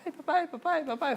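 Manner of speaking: imitated little girl, high-pitched pestering; child calling daddy. Daughter wants busy father's attention, high insistent child voice.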